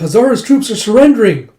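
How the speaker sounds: high, loud acted cry; alarmed report. Messenger reporting troops surrendering, alarmed shout. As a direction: cry out the bad news.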